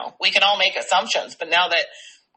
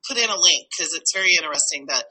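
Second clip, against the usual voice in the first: usual speaking voice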